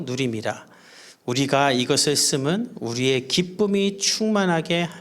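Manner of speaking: slow, measured Bible reading; unhurried recitation in evenly paced phrases; scripture reading aloud. Acted. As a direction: read the verse slowly for a congregation to follow. Reads the verses aloud with congregation.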